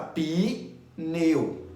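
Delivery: "pi, neu" as two separate slow chunks; mock syllable split, "pi" pause "neu"; wrongly split pi-neu. Shown as a demonstration. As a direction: break it after "pi", then a whole "neu". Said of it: "tem gente que é doido. Separa".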